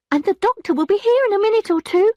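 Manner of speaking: high, loud maid's voice; maid's warning. A narrator's reading. Maid reminding Emma that the doctor is coming. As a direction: warn her the doctor is coming.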